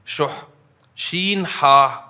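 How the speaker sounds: شح said slowly, then شین ہا spelled letter by letter; Arabic word spelled out. Shown as a demonstration. Preacher teaching the congregation an Arabic word, slow careful spelling. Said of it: "شح جسے کہا جاتا ہے عربی میں".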